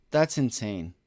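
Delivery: usual speaking voice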